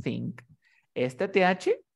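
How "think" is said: "think" with an unvoiced th; "think" with tongue between teeth, no buzz; think with theta. Shown as a demonstration. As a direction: blow air through the teeth to start "think". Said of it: "tiene el sonido de la teta".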